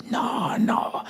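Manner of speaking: high, soft drawn-out refusal; resisting. Acted. Stubborn old self refusing to budge, resisting. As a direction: protest in a high, thin plea.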